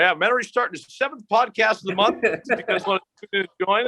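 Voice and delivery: high, hyped announcer parody; mock announcement. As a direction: sell it like a cheesy promo. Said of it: "I can't be like".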